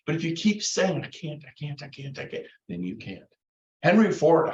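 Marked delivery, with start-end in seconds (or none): 1.0-2.5 muttered much softer under the breath; small, quiet mock self-doubt; defeated self-talk. Coach mimics someone telling himself he can't, softly defeated.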